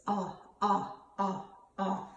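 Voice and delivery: short o as in octopus, repeated; letter sound demo. Shown as a demonstration. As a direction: sound out a short "aw" four times. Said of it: "Ollie Octopus says".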